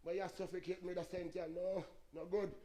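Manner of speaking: soft, choking complaint as the tree. Retold or imitated. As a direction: murmur like a suffocating tree. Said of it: "the tree, I say".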